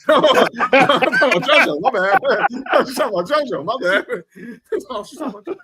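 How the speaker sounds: high, laughing apology; laughing my-bad. Real, not acted. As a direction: giggle through the correction. Laughing at own mix-up.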